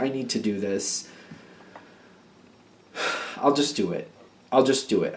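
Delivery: low, soft, sighing reluctance; resigned giving in. Acted. Reader voices someone agreeing to help, resigned and reluctant. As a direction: sigh and give in quietly.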